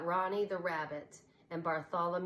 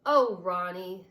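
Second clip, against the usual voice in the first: slow, louder fond scold; mild scolding; 10 dB louder, slower than usual. A narrator's reading. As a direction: tut at a naughty toy. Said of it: "scolded Bradley".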